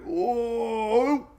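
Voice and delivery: high, rising mock whoa; whoa sound effect. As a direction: play the storm bumping the wall. Mimicking the storm bouncing off high pressure.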